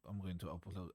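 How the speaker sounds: normal talking voice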